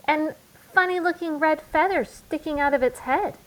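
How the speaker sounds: put-on high little-girl cub voice, embellishing; a small cub adding the finishing touch to a lie; Sister cub voice. Sister piling details onto the lie, high cub voice. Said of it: "said Sister".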